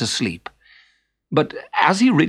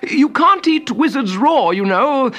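high, strained, urgent protest; gasping wizard; 11 semitones higher, 3 dB louder than usual. A narrator's reading. Wizard held by the throat dissuades troll, gasping high. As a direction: plead your case in a squeezed high voice. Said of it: "he gasped".

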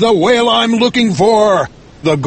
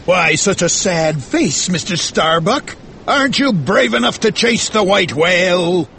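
raised-pitch, probing question; suspicious; 6 semitones higher than usual. Acted. Ahab questions Starbuck's courage, suspicious. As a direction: needle him with doubt. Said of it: "asked Ahab suspiciously".

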